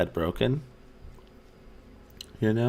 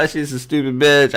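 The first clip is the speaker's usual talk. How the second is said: louder, higher mock-angry grumble at a stranger; mock angry insult; 8 semitones higher, 9 dB louder than usual. Wife quoting him insulting a woman outside, mock angry. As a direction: spit it out like a dazed insult, half joking. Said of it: "my wife's like".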